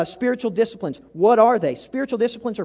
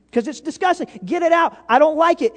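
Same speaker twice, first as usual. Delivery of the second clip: higher, louder, playfully grossed-out; mock disgust; 9 semitones higher, 6 dB louder than usual. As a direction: act like the first sip is vile. Acted disgust at first coffee.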